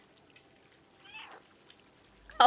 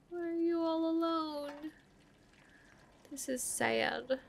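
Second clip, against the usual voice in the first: soft, high and tender; quiet sad coo; pitying; 5 semitones higher, 8 dB quieter than usual. Real, not acted. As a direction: feel sorry for it. Kitten alone.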